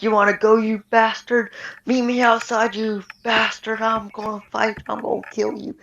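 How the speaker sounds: mock hothead daring him outside; angry challenge. Mark challenges him to fight.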